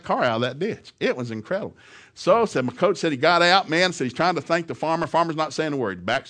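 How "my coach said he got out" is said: ordinary delivery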